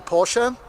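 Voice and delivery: "Porsche" in two syllables, por-shuh; German "Porsche", final e sounded; German pronunciation. Shown as a demonstration. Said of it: "Here it's actually".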